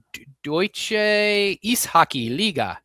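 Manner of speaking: clumsy attempt at a German accent. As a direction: say the league name in a rough German accent. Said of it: "forgive my butchered German accent there".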